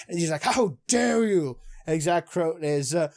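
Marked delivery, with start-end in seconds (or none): 0.4-1.6 slow, higher-pitched indignant outburst; mock angry. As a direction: imitate an outraged challenge, drawn out. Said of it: "Ryo gets really, really angry".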